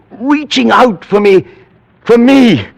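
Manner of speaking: rising terror pushing to a shout; frantic cry. Acted. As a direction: cry out as if already seized. Frenzied climax about tentacles.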